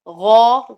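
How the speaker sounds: teacher demonstrating the ghain sound; heavy ghain sound. Shown as a demonstration. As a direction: push out a heavy rounded غو. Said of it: "غین کی جو آواز ہوتی ہے غو".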